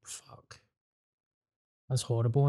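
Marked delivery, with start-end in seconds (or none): 0.0-0.6 breathy whispered swear, barely voiced; whispered curse. Real, not acted. Friend hearing about meth and milk, real whispered shock. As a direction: let the word slip out under your breath.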